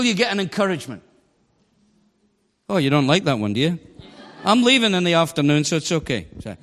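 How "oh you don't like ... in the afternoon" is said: usual speaking voice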